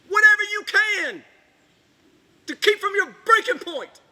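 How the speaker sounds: loud, desperate shouting at the Internet; angry outburst. Acted. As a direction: voice rising into a raw, loud rant. Breaks down ranting at the Internet.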